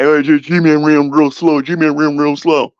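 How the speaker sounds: slow, dopey voice mocking a critic. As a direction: drag it out like a dim heckler. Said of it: "if you wanna give me some constructive criticism".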